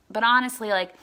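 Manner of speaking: ordinary delivery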